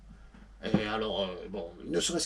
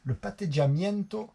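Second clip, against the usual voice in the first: Italian word with his Marseille accent; 7 semitones higher than usual. Shown as a demonstration. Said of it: "Désolé pour l'accent".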